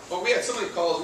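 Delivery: usual speaking voice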